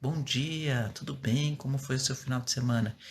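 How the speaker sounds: quiet, friendly hello to staff; soft gentle greeting. Shown as a demonstration. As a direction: greet them softly so no one feels scolded. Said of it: "Então, eu falava".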